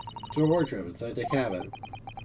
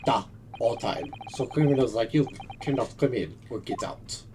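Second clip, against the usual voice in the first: put-on Russian accent; 3 semitones lower than usual. Plays Russian sailor.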